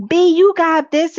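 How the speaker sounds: loud, high, slow hype call; cheering hype. Retold or imitated. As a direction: hype her up like a proud daughter. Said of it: "daughter was talking to the mama like".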